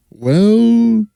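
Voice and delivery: a tiny dog's sudden high well. Acted. Dog answering the flea, high voice.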